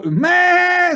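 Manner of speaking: very high, loud sheep cry; old ewe answering the piglet's cry; old ewe calling. Acted. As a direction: bleat back from next door. The voice next door.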